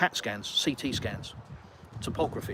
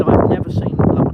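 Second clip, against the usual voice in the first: loud, higher amazed exclamation; shocked doctor; 5 semitones higher, 14 dB louder than usual. Retold or imitated. Doctor reacts to huge lumps in loud amazement. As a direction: exclaim it, stunned. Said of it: "He said".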